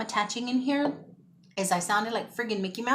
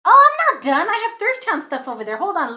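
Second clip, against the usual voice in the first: loud, high burst of realization; sudden remembering; 10 semitones higher, 12 dB louder than usual. Real, not acted. Vlogger remembering more thrift finds, loudly startled. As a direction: blurt it out, catching yourself mid sign-off.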